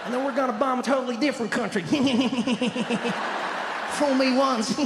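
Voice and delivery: weird chuckly president voice; George Bush. Acted. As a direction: tack a long stuttering snicker after each line. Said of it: "He always did that weird chuckly thing".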